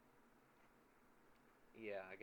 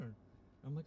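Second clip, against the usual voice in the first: ordinary delivery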